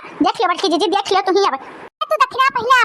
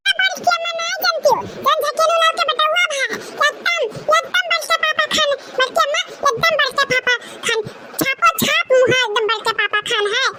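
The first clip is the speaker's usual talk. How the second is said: put-on high child voice; 8 semitones higher than usual. Child gossips about the boy's looks.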